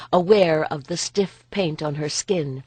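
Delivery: ordinary delivery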